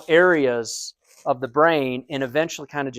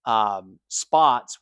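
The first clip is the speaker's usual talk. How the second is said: normal talking voice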